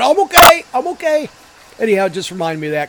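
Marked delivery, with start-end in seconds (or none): 0.0-1.3 high, loud squeaky cartoon voice; goofy dazed reassurance. Driver at his window after the crash, high loud goofy voice. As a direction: chirp it out through the airbag. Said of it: "He's rolling down his window going".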